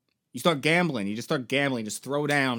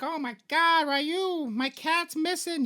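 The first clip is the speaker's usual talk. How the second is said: very high, put-on little-girl voice; mock a distressed little girl, stretching the end; 11 semitones higher, slower than usual. Little girl tells Ryu about her missing cat, high mock-child voice. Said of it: "there's some little girl. She's like".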